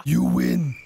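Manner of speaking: low, slow sinister rasp; parrot voice. Acted. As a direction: loom over them as a monster. Parrot voice delivers a deadly prize.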